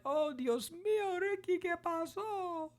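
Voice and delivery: high, slow Spanish cry of worry; Spanish exclamation. Retold or imitated. Worried girls rush to Ricky, Spanish and high. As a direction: gasp it out in high, drawn-out Spanish. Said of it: "She's talking in Spanish".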